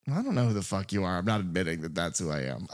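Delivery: slightly low, quick evasive aside; guarded man. Retold or imitated. Host voices a man refusing to admit who he is, lightly guarded.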